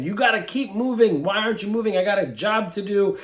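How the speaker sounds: high, impatient yelling at the donkey; a frustrated master hitting his stubborn donkey; angry Balaam. Acted. Balaam scolding his donkey, high and impatient.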